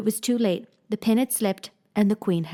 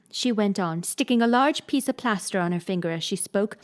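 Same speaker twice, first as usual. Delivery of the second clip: ordinary delivery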